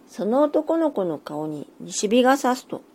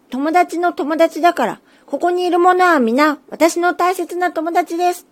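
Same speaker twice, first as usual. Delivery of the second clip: higher and louder still, pressing the point; even higher and firmer; 8 semitones higher, 6 dB louder than usual. Acted. Little tengu insists all here are his friends, high firm climax. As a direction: climb up and insist on "私の大切な友達です". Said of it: "甲高い声できっぱり言いました".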